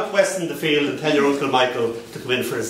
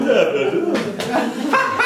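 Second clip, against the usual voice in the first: high, drawn-out babble; slow mock blathering; man in Camden; 9 semitones higher, much slower than usual. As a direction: imitate a man babbling slowly. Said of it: "and he was going".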